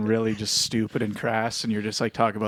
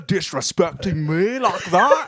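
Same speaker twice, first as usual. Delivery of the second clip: loud, high, slow fake Scottish; mad Scottish guy; 8 semitones higher, 5 dB louder, slower than usual. Offended Scottish panelist scolding comic, mock-angry Scottish accent. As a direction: rage in a terrible Scottish accent. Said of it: "kinda mad".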